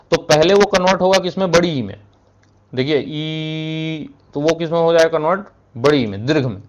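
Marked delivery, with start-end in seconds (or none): 2.7-4.1 drawn-out soft ई sound held long; teacher holding the vowel ई long and quiet; demonstrating ई. Shown as a demonstration. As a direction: stretch ई slowly and softly as a demo. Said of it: "ध्यान से सुनिए".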